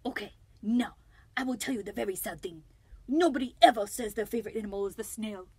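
bad French accent, mock tragedy; Escargot. French snail telling listeners his very sad thing, very bad French accent. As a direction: confess a sorrow in a hammed-up French accent. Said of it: "a very, very bad French accent".

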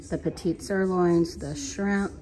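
normal talking voice

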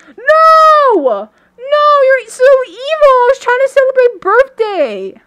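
high, loud wounded cry of protest; outraged shout. Real, not acted. Players spoil his birthday cake, high loud outraged shout. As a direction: shout out that the party is ruined.

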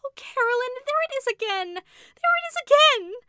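high, loud, terrified wailing cry; wild wail. A narrator's reading. Rebecca panics at the shadow on the wall, wild terrified wail. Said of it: "burst out in a wild wail".

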